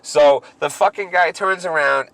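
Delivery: ordinary talk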